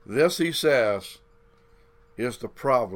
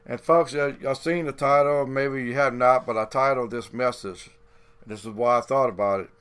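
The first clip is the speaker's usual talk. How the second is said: ordinary talk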